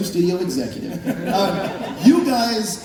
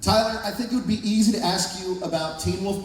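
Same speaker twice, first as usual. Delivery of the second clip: usual speaking voice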